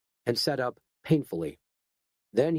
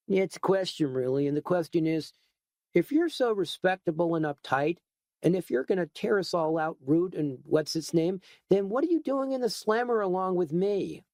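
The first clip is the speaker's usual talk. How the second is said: smug retort; 6 semitones higher than usual. Acted. Shark smirking retort.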